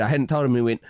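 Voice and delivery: normal talking voice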